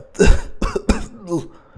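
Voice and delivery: violent gagging cough, high and strained; retching cough. Acted. Fledgling gags on the filthy bum's blood, retching. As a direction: choke on foul blood.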